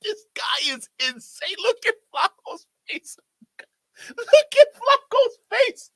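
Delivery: very high, squealed through laughter; helpless high-pitched squeal of amusement; cracking up. Real, not acted. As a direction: wheeze it out, barely able to talk for laughing. Cracking up at someone's reaction.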